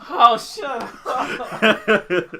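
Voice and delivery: drawn-out laughing shut up, high and loud. Real, not acted. Friend mocked, laughing shut up. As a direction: laugh it out in disbelief.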